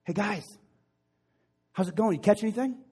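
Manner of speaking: high, friendly call across the water. Acted. Jesus hailing the fishermen from shore, calling out. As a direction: call out a question to a distant boat. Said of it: "he yells out on the water".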